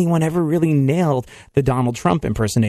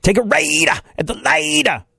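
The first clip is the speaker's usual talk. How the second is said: higher, growly metal drawl; 7 semitones higher than usual. Retold or imitated. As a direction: say it like a metal frontman. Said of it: "I just stick with what I know".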